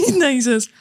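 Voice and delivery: ordinary talk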